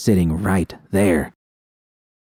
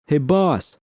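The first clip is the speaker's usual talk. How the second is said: a higher, raised call across the room; 8 semitones higher than usual. A narrator's reading. Gang member calling to Bishop from behind, calling out. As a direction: call "Hey, boss" from a distance. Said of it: "a voice called out behind me".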